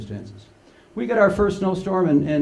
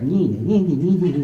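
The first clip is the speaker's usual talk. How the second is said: mock kid whine; 4 dB louder than usual. Child nagging a tired parent.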